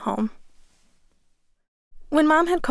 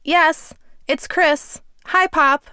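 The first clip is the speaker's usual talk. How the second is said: slow, louder, higher words so a deaf man can hear; slowed-down shouting; 5 semitones higher, 4 dB louder, much slower than usual. Retold or imitated. Grandson speaking to his deaf grandfather on the phone, slow loud shout. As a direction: stretch each word out and shout it kindly.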